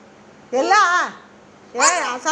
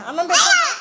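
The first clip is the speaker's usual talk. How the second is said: crying high retort; 9 semitones higher, faster than usual. Real, not acted. Child crying back at mother, high and tearful. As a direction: wail it back through tears.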